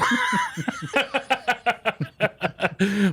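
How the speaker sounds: long, high burst of laughter; helpless cackling at a prank; big laugh. Real, not acted. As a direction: crack up and keep laughing. Host cracks up at the prank.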